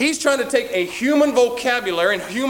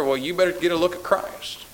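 ordinary talk, nothing marked

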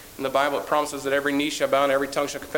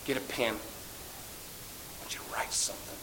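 softer, slow whisper; Jesus whispering to John; whispered command; 4 semitones lower, 14 dB quieter, slower than usual. Acted. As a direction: confide a sacred instruction in a whisper. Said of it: "he said".

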